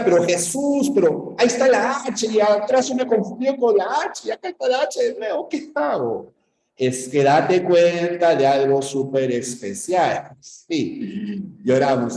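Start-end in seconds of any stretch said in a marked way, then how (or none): 0.0-6.3 higher-pitched confused student complaining; alumno confundido. Acted. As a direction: plead like a bewildered pupil, pitched up. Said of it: "Ahora, tú me dirás". (alumno confundido quejándose, en tono agudo)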